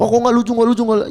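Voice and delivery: high, loud whiny mock of a bored audience member; mocking complainer. Comic mocking a viewer who says he isn't funny. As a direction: sneer it like a disappointed viewer. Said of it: "Kalau ada orang bilang".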